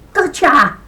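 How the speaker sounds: loud gleeful gotcha; triumphant cry. Acted. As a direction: pounce on it with a shout. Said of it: "cried Niall O'Leary".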